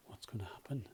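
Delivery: hushed suspense whisper; whisper, wondering what comes next. Acted.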